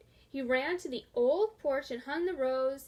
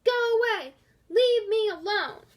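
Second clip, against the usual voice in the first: yelling; 8 semitones higher, 8 dB louder than usual. A narrator's reading. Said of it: "shake her broom and yell".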